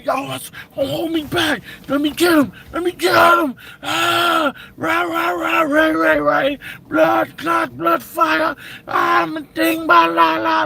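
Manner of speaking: high, loud hyped-up shouting held back by friends; hammed-up fake rage, straining to get loose; riled-up mock yelling. Guy held back by his boys, shouting mock rage. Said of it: "you start getting more riled up".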